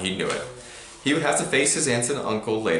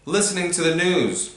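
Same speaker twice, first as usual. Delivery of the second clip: resigned; 8 semitones higher than usual. A narrator's reading. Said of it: "said Harry in a resigned voice".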